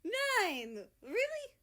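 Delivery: high, loud, thrilled squeal; overjoyed shriek of surprise; delighted disbelief. Acted. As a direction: gasp with excited disbelief. Germany thrilled by the news.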